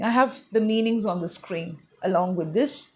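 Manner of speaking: usual speaking voice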